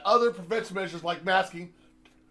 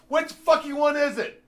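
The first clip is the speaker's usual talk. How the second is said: angry demand, higher and louder; fed up, demand an answer; angry rhetorical demand; exasperated; 6 semitones higher, 3 dB louder than usual. Real, not acted. Ranting at contradictions.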